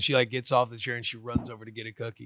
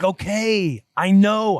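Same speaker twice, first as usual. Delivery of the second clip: put-on higher, louder little-kid reply; impatient toddler; 7 semitones higher, 8 dB louder, much slower than usual. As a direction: answer like a kid brushing off a parent. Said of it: "And she's like".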